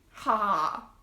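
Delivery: ordinary delivery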